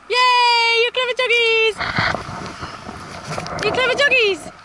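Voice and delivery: high, bright cheering for the dogs; excited cheer. Real, not acted. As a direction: celebrate the dogs like they've won. Cheering dogs after photo.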